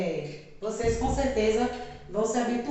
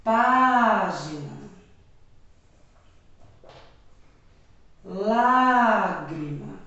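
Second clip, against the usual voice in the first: "Página" and "Lágrima" said very slowly and louder, leaning on the first syllable; slow stressed example; 3 semitones lower, 3 dB louder, much slower than usual. Shown as a demonstration. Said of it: "E um exemplo de proparoxítona". ("Página" e "Lágrima" bem devagar, com ênfase na primeira sílaba)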